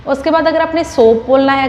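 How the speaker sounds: normal talking voice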